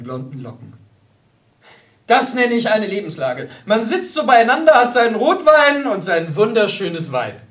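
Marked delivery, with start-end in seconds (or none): 2.1-7.4 loud, high, hearty proclaiming; expansive contentment. Acted. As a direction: hold forth with relish over the wine. Hans expansively praising his life.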